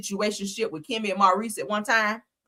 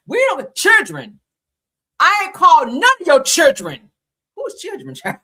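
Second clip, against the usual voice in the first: mocking; 6 semitones higher, 9 dB louder than usual. Mocking Wanda's word children.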